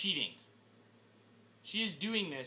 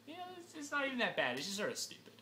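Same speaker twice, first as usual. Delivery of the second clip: ordinary talk, nothing marked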